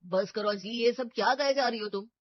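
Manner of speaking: loud, firm telling-off; stern scolding. Acted. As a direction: shut her outburst down. Mother-in-law scolds the outburst.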